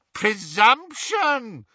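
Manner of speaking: high, slow, drawn-out jeer; mocking echo. Riddle Hermione echoing the insult at Ron, mocking. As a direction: stretch the word into a high jeer. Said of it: "echoed the Riddle Hermione".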